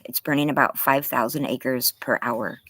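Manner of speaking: normal talking voice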